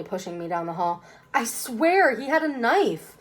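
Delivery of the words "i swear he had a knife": high, slow, loud plea; pleading protest. Acted. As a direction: swear it, desperate. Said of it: "One last protest".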